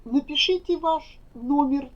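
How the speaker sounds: ordinary talk, nothing marked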